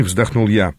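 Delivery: ordinary delivery, nothing marked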